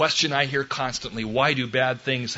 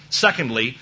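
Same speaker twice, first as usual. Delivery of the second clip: ordinary talk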